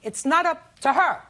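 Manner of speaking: loud, high reprimand; stern lecture. Real, not acted. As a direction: judge scolding firmly. Judge lecturing the mother.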